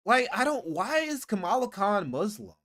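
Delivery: normal talking voice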